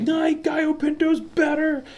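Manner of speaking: very high, slow mock outrage; angry skillet attacker. Imagined intruder on a cooking show, high hammed-up outrage. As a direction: play it as a cartoonish furious intruder. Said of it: "attacks them with a skillet 'cause they're like".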